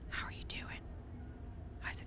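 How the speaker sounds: voiceless whispered greeting; hushed playful whisper. Real, not acted. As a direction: lean in and whisper it. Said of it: "whispered aside greeting".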